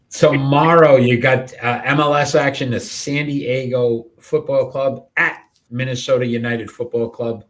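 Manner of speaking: host introducing the soccer match; announcer voice. Acted. Host announcing next game.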